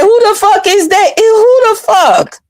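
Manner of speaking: high-pitched, stunned who-is-that; outraged disbelief. Retold or imitated. Retelling her reaction to a stranger on the call, high and outraged. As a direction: squeal it out in shocked outrage. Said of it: "I said".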